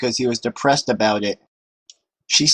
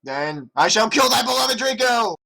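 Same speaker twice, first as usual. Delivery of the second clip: villain threat; 7 semitones higher than usual. A narrator's reading. Voldemort threatens.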